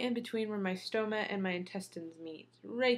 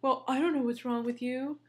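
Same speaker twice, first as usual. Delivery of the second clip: higher-pitched retold shrug; dismissive mock surgeon; 4 semitones higher than usual. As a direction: mimic the surgeon brushing her off, pitched up. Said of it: "shrugging his, you know, hands and up".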